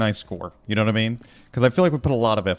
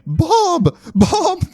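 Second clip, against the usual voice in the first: loud, very high falsetto shrieks; hysterical calling; 10 dB louder than usual. Pretend. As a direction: shriek the name in mock shock.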